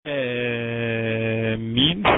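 آمين with the opening «آ» held very long; madd elongation. Shown as a demonstration. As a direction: chant آمين slowly on a steady first vowel. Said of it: "مد لازم كلمة مثقل". (مدّ طويل مرتّل في أول آمين)

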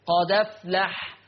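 "قد أفلح" joined slowly as "qada-flah"; slow, with the dal of "قد أفلح" taking the fatha; hamza dropped. Shown as a demonstration. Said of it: "فتصير المحصلة دال مفتوحة". ("قد أفلح" بنقل الفتحة إلى الدال: "قَدَفْلَح")

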